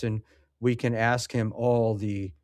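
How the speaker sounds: ordinary talk, nothing marked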